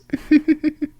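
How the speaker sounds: light mocking chuckle; teasing laugh. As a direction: laugh down at the naughty pet. Mistress teasing the pet.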